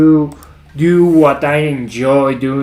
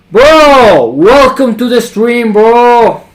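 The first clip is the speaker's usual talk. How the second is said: excited greeting; 7 semitones higher, 5 dB louder, slower than usual. Real, not acted. Streamer greets viewers loudly.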